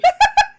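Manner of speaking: high squealing laugh; real laugh bursting out; sudden high laugh; burst of laughter. Real, not acted. Players laugh at accent joke.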